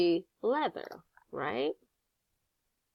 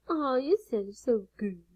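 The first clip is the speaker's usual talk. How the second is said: put-on baby talk; 5 semitones higher than usual. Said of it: "I talk to you like I do my grandson".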